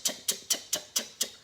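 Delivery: quick voiceless tick tick tick like a homing missile; ticking sound. Retold or imitated. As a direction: click it out like a missile locking on. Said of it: "I'm like an Exocet missile".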